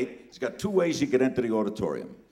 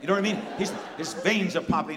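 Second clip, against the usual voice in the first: ordinary talk, nothing marked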